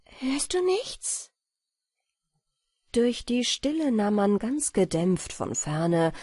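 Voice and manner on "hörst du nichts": high, slow and anxious listening; fairy straining to hear danger; anxious question. Acted. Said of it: "rief sie plötzlich".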